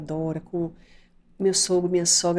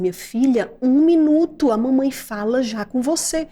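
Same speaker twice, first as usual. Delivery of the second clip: loud, high, firm mother; stern loud tone; 5 semitones higher, 5 dB louder than usual. Retold or imitated. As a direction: raise the voice sharply at the child. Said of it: "Desse jeito que eu tô falando".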